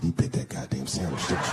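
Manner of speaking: wife accusing her husband, low deep mock voice; stern wife. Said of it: "She said".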